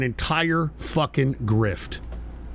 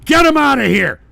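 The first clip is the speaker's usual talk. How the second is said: loud, raised, furious commands; angry ejection orders; 5 semitones higher, 6 dB louder than usual. Real, not acted. Streamer kicking hostile chatters out, angry shouting. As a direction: throw them out at rising volume.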